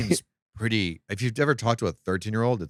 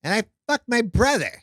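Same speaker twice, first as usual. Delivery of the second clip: pitched up high, whiny mock version of her; a hammed-up, higher caricature of a friend; impression of Jordan; 10 semitones higher than usual. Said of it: "You don't sound like that at all".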